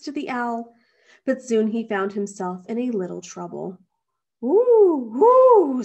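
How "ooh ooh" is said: loud, high swooping hoots; angry owl hoot. A narrator's reading. Owl crowded by a climbing bear, hooting angrily. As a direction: hoot indignantly, twice. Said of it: "said the owl angrily".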